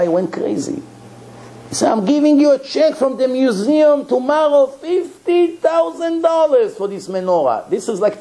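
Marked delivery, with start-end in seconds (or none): 1.9-7.7 excited offer. Retold or imitated. Eager museum man's offer.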